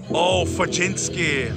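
ordinary talk

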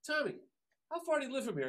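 high, friendly question. Retold or imitated. Bandleader asking the young drummer, high and friendly. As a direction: ask casually, pitched high. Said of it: "So he goes".

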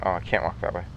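usual speaking voice

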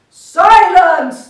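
loud angry bark. A narrator's reading. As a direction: bark the order furiously. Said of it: "barked back".

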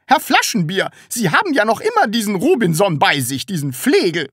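high, loud indignant shout; calling from outside. A narrator's reading. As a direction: call angrily through a closed door. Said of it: "rief sie von draußen". (empörtes, hohes Rufen)

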